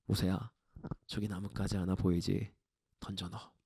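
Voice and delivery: very low, hushed divine voice; God's calm answer. Acted. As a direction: answer the prayer quietly, with calm authority. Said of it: "하나님은 이렇게 응답하십니다".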